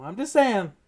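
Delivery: teasing his co-host, insistently repeating his point; pointed jab. Real, not acted. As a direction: press the point home with a teasing needle. Repeating his jab at the Brees pick.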